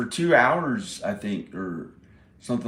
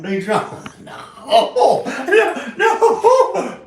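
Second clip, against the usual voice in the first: laughing, rising much higher into squeals; 11 dB louder, much slower than usual. Real, not acted. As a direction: protest through real laughter. Laughing at his own video.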